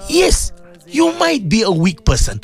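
high exhortation, climbing to a peak; impassioned preaching. Acted. Urging listeners to become good Muslims, high impassioned preaching.

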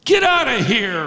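high, shouting, hostile heckle; angry heckle. Retold or imitated. Man heckling Jesus in the synagogue, shouting angrily. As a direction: yell at the preacher to get lost. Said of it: "he's essentially saying".

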